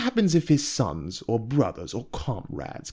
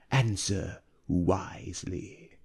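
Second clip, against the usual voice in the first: soft, hushed warning; a hushed threat to the merchant; whispered; 6 dB quieter, slower than usual. A narrator's reading. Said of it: "whispered Anthuria".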